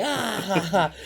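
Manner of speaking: high mock crowd laughter; crowd laughing. Crowd laughing at the heckle, high mock laugh. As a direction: laugh like the audience. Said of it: "they were like".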